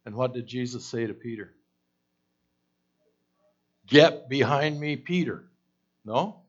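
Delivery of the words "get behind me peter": stern rebuke. Retold or imitated. Jesus rebuking Peter.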